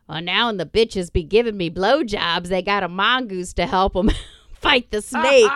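imitating her son. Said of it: "my kid fucking goes".

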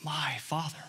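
a hushed, intimate call to the Father; tender soft plea. Retold or imitated. Jesus quietly calling to his Father in prayer, hushed and tender. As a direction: breathe it low like a son in the garden. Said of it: "He says".

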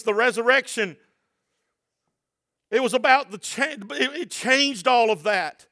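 high, loud sermon delivery; impassioned preaching. Real, not acted. Preacher urging the church about tears and prayer, impassioned preaching.

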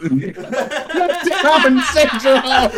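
high, slow, dissolving into laughter; cracking up on each word; laughing. Real, not acted. Repeating joke while cracking up.

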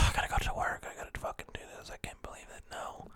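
hushed, voiceless dread; whispering to himself. Retold or imitated. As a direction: whisper it like you don't want to go. Voicing his own dread about work.

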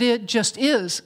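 usual speaking voice

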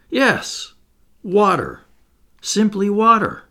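high, emphatic exclamations; excited reveal. A narrator's reading. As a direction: announce it like a brilliant discovery. Inventor eagerly reveals his discovery.

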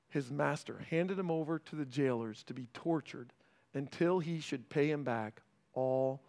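ordinary delivery

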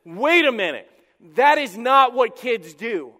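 loud, emphatic indignation; worked-up objection. Acted. Preacher voices Pharisees objecting to the story, worked-up. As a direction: object loudly, drawing out each word. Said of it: "they would have been worked up".

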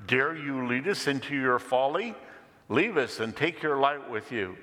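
indignant rebuke. Acted. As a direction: banish the woman with a rebuke. Cave dweller angrily rebukes woman.

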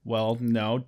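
ordinary delivery

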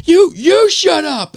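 high, slow mock shout; imitated angry yelling. Stranger snapping back at the husband, imitated yell. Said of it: "it's this dude yelling at my wife".

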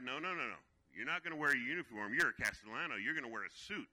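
loud, stern father forbidding; stern dad. Retold or imitated. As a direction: lay down the law as the angry dad.

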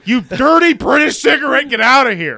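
high loud mock insult; mock angry yell. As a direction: bark it like a cranky old Brit.

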